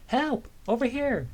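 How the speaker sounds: very high, desperate cry for help; calling for help. Acted. Person in lake calling out.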